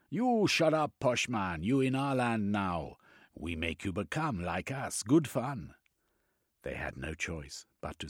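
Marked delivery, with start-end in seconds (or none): 0.1-1.0 high, loud snap of a command; YOU hit high and loud; tribesman's rough order. Acted. Tribesman silences the captive. 1.0-5.7 high, taunting broken English; mocking tribesman lording it over him. Tribesman mocks captive.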